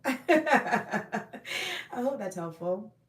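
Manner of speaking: repeated with a laugh. Shown as a demonstration. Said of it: "So, I hope that's helpful".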